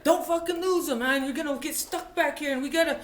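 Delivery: high-pitched, loud mock nagging; mad engine boss. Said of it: "boss is getting mad at me".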